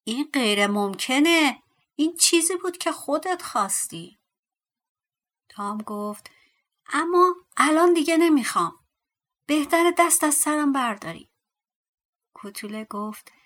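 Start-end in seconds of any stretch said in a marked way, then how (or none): 0.0-4.2 loud, rising protest; dwarf's shout. A narrator's reading. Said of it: "کوتوله فریاد زد". 6.9-11.3 loud, high-pitched demand; angry insistence. A narrator's reading. Angry Tom rejects the dwarf.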